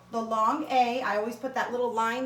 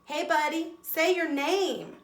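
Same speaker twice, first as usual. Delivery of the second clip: higher, slow coaxing letter voice; voicing the letter E; 5 semitones higher than usual. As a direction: speak as the E cheering on the A. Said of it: "that E is telling this A".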